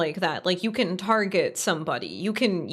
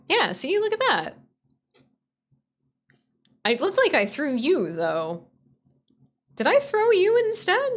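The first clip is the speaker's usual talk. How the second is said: high excited squeal; 11 semitones higher than usual. Real, not acted. Laughing at bunnies colliding.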